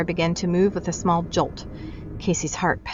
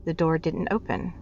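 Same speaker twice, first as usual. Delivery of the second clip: ordinary delivery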